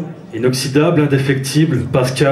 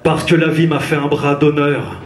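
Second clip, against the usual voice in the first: rhythmic spoken-word slam delivery; slam poetry; 6 semitones higher than usual. Acted. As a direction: perform it like a slam poet on stage. Slam poem performed on stage.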